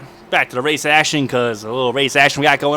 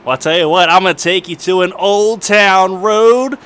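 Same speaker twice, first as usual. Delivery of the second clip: cowboy voice; 6 semitones higher, 5 dB louder than usual. Pretend. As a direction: ham up a country cowboy twang. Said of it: "I sound like a cowboy".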